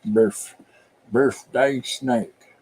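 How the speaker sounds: drawn-out Appalachian with a still upper lip; Appalachian accent. Shown as a demonstration. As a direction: stretch it slowly, Appalachian style. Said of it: "'cause your upper lip doesn't move".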